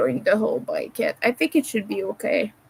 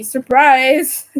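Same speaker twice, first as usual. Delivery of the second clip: mock surprise; 2 semitones higher, 8 dB louder than usual. Sarcastic reveal of obvious fact.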